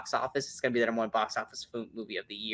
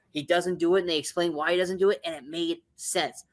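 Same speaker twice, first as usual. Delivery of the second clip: normal talking voice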